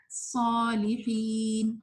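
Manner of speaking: slow, stretched صالحین with heavy unrounded ص; correct articulation. Shown as a demonstration. Teacher modelling good articulation, slow heavy ص in صالحین. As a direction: draw out both long vowels of صالحین, no w. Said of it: "بہت اچھا مخرج ادا آپ کا ہو جائے گا".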